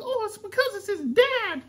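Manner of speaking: very high falsetto quote; high-pitched student voice. Retold or imitated. Dad repeats the student's words in falsetto. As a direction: squeak it up like the girl. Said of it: "High pitch".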